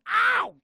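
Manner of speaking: sudden high yelp of pain; alarm shout. Acted. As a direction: yelp as if struck. Baton hits him in head.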